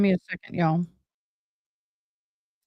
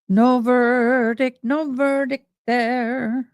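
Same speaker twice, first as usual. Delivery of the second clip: "No verdict" stretched out slow and a bit higher; slow drawn-out repeat; 3 semitones higher, much slower than usual. Real, not acted. Drawn-out "No verdict" repeated slowly to the viewers. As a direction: savour "No verdict" slowly, singsong.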